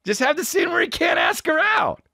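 very high, strained yell; comic outrage at the top of the range; exasperated shout. Acted. Ranting about a TV show's scene, exasperated shout. Climax of rant against the show.

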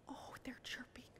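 barely audible whisper; hushed wonder. Pretend. Teacher listens with children to chirping birds, whispered.

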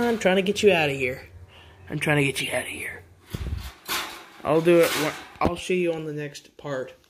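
ordinary talk

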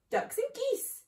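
high, eager small-fox squeal; excited cry. A narrator's reading. Small foxes crying out over a farm's food, excited. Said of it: "cried the small foxes".

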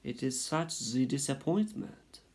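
put-on high, slow French-accented lament; sighing French mother. A narrator's reading. Mother mouse laments her only baby, sighing French accent. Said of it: "She sighed".

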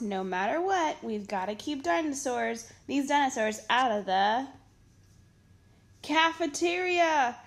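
ordinary delivery, nothing marked

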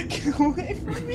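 very high laughing shriek; panicked shriek. Real, not acted. Fleeing, laugh-cry.

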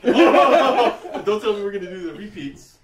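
slow, laughing complaint. Real, not acted. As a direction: laugh while protesting. Laughing at repeated pick.

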